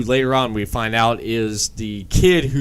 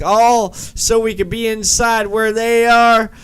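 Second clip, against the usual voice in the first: sarcastic Connie; 9 semitones higher than usual. Retold or imitated. Connie objecting in retold scene.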